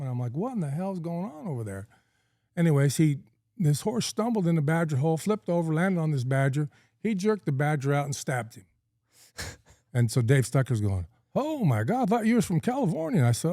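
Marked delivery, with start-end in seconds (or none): 0.4-1.9 high, softish mock disbelief; acted incredulous question, pitched up; retold bafflement. 11.3-13.2 very high-pitched acted astonishment; animated mock disbelief; imitated amazement.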